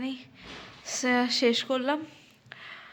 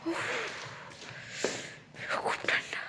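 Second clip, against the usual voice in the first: whispering; 11 semitones higher, 12 dB quieter than usual. Real, not acted. Very cold.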